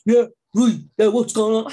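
put-on strong Hampshire accent. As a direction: imitate a brother's broad Hampshire talk. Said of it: "He had the strongest Hampshire accent going".

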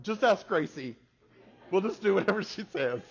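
high, loud mimicked teenagers; other girls' reply.

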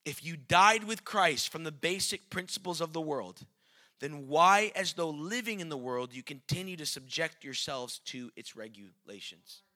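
usual speaking voice

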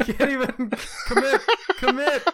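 high laughing tease. Real, not acted. Laughing teasing.

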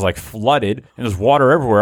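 ordinary delivery

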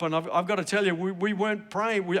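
normal talking voice

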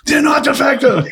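high, loud exasperated yell; guard finally losing it with the inmate, shouting; mock shout. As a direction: explode like a guard who finally lost it. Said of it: "Finally, he goes".

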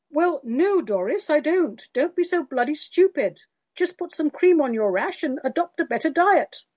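high, irritated scolding voice; exasperated husband. Retold or imitated. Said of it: "conversations in people's heads".

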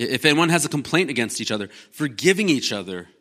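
closing the sermon with scripture, softening; scripture reading. Acted. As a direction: let the verse settle gently. Reads scripture over music.

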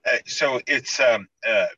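ordinary talk, nothing marked

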